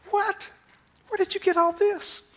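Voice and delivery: high, soft astonished question; amazed disciples. Retold or imitated. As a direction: gasp at the cooked breakfast.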